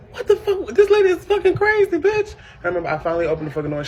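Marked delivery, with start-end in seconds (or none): 0.1-2.3 high, loud disbelieving outburst; shocked yell. Retold or imitated. Daughter yelling as mom breaks the door. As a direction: react loud to being attacked. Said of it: "I'm like".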